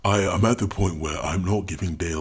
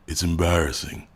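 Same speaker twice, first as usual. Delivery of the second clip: ordinary talk, nothing marked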